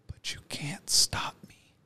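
slow, intense whisper; hushed, deliberate defiance; defiant whisper. Pretend. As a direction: whisper it slowly, like a vow to her face. Imagined defiant words to his attacker.

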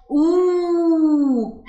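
"أوه" held as a high, long "ooo"; long oo vowel. Shown as a demonstration. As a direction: sustain "أوه" slowly. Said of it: "صوته بيبقى عامل ازاي". ("أوه" بمدّ طويل بالواو)

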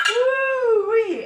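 high delighted woo-wee; excited woo-wee. Real, not acted. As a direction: squeal with pleasure at the plate. Cheering at decorated plate.

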